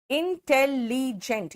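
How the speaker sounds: very slow इंटेलिजेंट split into in-tel-li-gent; slow syllable-count demo. Shown as a demonstration. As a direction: pull each syllable apart slowly. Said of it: "यहाँ पे चार है".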